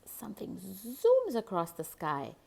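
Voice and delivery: ordinary delivery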